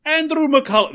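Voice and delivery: high, loud mock-Irish cry; Lucky Charms guy. Testing a name on his co-host, hammed-up Irish accent. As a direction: sing out the name in a cartoon Irish brogue. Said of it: "if I just do the Lucky Charms guy for all of them".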